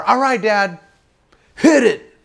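high call out to the boat; acted-out high-pitched holler. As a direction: holler across the water. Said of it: "And I holler".

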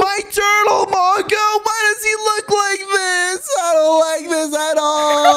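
hammed-up tearful high whine; upset wailing. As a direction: cry out in distress over a beloved pet. Upset over his changed pet turtle.

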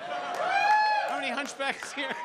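squeaky high, stretched-out question; high slow squeal. Acted. Comic to the crowd, high and stretched. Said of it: "That's a terrible thing to say".

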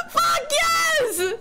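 ecstatic high-pitched shout with laughter; shrieking with joy; real excited outburst. Real, not acted. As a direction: scream it like an impossible win just landed. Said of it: "I got really excited there".

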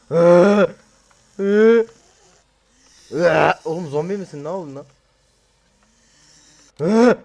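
loud high yelps of fright at a zombie; startled yelps. Real, not acted. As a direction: yelp each time it lunges. Zombie lunges at him.

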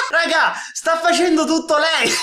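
laughs through the words; can't keep a straight face; laughing. Real, not acted. Reacting to the clip, laughing helplessly.

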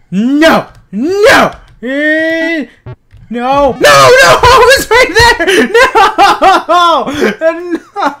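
screaming high and loud in despair; frustrated screaming. Real, not acted. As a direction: yell it out as the shot slips away. Missing the shot in game.

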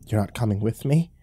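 ordinary delivery, nothing marked

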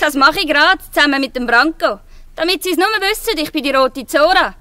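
loud, proud and cheeky; defiant call. Acted. Zora proudly calls her name to the guard, loud. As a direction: throw her name at him. Girl defies the guard openly.

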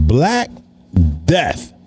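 drawn-out and ominous; slow it down and savour the name; slow dramatic emphasis. Real, not acted. Stretching the candy's name for effect.

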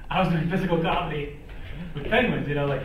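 real laughter at the memory; laughing. Real, not acted. Actor telling the interviewer about penguins, laughing.

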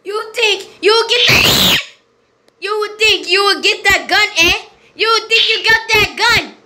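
high-pitched, indignant challenge; taunting shout. Real, not acted. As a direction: yell it mockingly at a rival player. Taunts rival going for gun.

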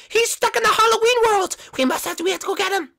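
very high, loud squeaky alarm; panicked sidekick. Acted. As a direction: cry for a rescue in a tiny piercing voice.

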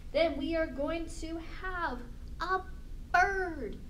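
ordinary talk, nothing marked

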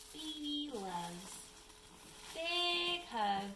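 drawn-out, high storybook lilt; reading to a small child. Acted. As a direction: read it slowly to a little one. Reads board book to child.

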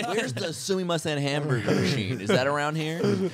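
high, hammed-up American tourist accent; American impression. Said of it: "I love American impressions".

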